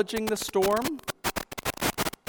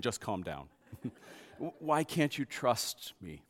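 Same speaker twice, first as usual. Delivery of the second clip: compassionate; 5 semitones lower, 7 dB quieter than usual. Acted. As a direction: soothe them kindly. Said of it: "beautifully compassionate face".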